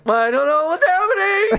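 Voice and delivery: hammed-up confused meltdown; mock bewildered wail. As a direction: pitch climbing high and loud. Acts out her bewildered meltdown.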